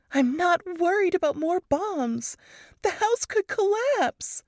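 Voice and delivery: scratchy, scared pleading; Varys trembling. A narrator's reading. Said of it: "Varys's trembling voice was scratchy".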